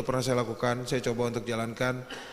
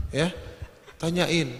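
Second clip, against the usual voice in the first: ordinary talk, nothing marked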